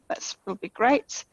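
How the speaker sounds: normal talking voice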